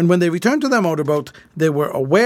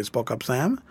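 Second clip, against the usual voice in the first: ordinary delivery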